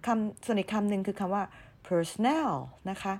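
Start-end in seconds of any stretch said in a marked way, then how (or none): 1.9-2.5 personnel with NEL lifted and stressed; stress on last syllable. Shown as a demonstration. Teacher introducing the other word, "personnel" stressed on NEL. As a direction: push the final NEL of "personnel". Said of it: "เราจะเน้นในพยางค์ที่สาม ตรง N E L".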